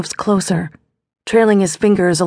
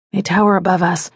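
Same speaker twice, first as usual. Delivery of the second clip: ordinary delivery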